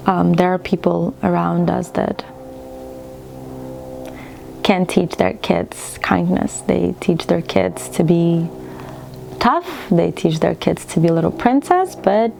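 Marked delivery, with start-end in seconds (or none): none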